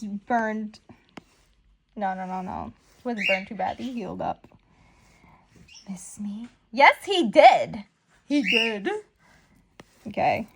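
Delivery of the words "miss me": taunting whisper. Acted. Villain's taunting return line.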